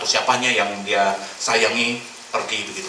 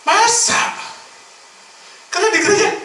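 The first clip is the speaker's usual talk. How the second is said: much higher and louder, incredulous; can't believe it; real disbelief; 6 dB louder than usual. Real, not acted. Pastor reacting in loud, high disbelief to the abuse. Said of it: "Saya sampai kaget".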